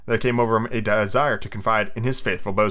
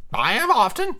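high sidekick voice; high-pitched henchman; Smee; 11 semitones higher than usual. Acted. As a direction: pipe up high to the captain. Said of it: "said Smee".

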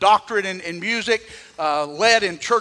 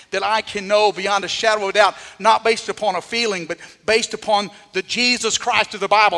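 ordinary talk

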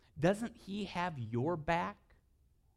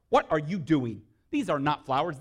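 louder, playful fatherly scolding; mock scolding dad; 9 dB louder than usual. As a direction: scold the girls in jest, loud. Dad scolds daughters over dandelions.

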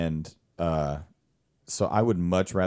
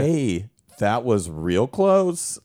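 imitated Welsh pub-goer; 5 semitones higher, 5 dB louder, much slower than usual. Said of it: "this guy walks up to us".

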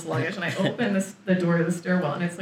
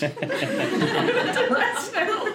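laughing; 13 semitones higher, much slower than usual. Retold or imitated. Out of breath on stairs.

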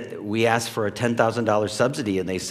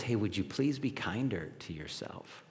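tender voice of the Lord; 3 semitones lower, 12 dB quieter than usual. Retold or imitated. Lord speaking gently.

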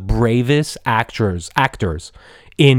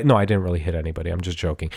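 normal talking voice

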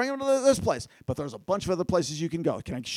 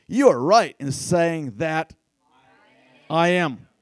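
slow, weighty and louder, stress on "You" and "I am"; solemn slow quote; 3 semitones lower, 5 dB louder, slower than usual. Shown as a demonstration. Preacher showing the youth group how Jesus said it, slow and solemn. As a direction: lay each word down like a proclamation. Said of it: "I want you guys to see how he says it".

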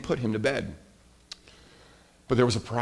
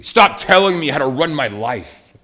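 loud, higher-pitched mock outburst; hammed-up angry retort; acted angry outburst; 4 semitones higher, 8 dB louder than usual. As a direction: yell back like an indignant kid brother. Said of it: "And then I get angry".

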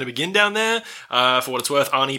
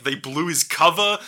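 usual speaking voice

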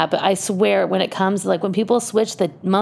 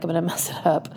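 whispered, laughing confession; sheepish laughing aside; 3 semitones lower, 5 dB quieter than usual. Real, not acted. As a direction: breathe it out with a giggle. Admitting she'll mess up.

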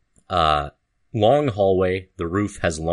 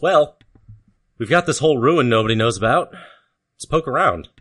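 higher, louder eager adventurer; Corden voice; 7 semitones higher, 5 dB louder than usual. Acted. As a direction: pitch it up with excited curiosity. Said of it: "Corden says".

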